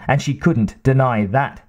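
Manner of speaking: plain reading voice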